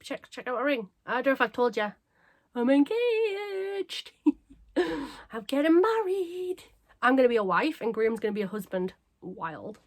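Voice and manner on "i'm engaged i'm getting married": high, stretched-out squeal of joy; gleeful announcement. Real, not acted. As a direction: draw the big news out slowly, high and giddy. Gleefully announcing her engagement to viewers.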